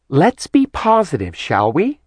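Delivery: put-on high, sing-song, talking to small children; kindergarten teacher voice. A narrator's reading. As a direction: coax them like a room of five-year-olds. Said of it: "in the voice of a kindergarten teacher".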